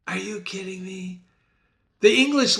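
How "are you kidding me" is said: soft, understated imitated reaction; hushed mock disbelief. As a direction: say it much quieter, as a muted aside. Same stock reaction, toned right down.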